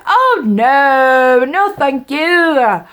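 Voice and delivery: high, loud put-on British refusal; British accent. Baker refusing an invitation inside, suddenly British. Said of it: "I turned British".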